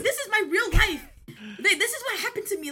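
high, loud, laughing protest, a bit fast; laughing insistence. Real, not acted. Insisting to friends the story is real, laughing. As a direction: swear it's true through laughter. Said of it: "insisting while laughing".

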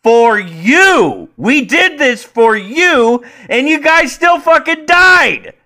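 high, loud, drawn-out shouted tirade; exasperated screaming rebuke; furious rant. Acted. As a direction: yell it in their face, stretching the key words. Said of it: "I wanna grab them and be like".

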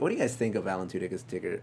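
normal talking voice

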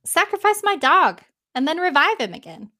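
lightly voiced lord calling out. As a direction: call across the court. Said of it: "calls out".

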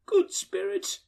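high, imploring; pleading Scrooge. A narrator's reading. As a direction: fall at its feet and beg. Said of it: "he pursued".

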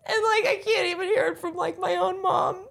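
crying and half laughing through the words; real crying. Real, not acted. Daughter tells her friend about her mom, crying.

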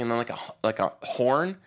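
normal talking voice

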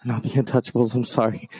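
normal talking voice

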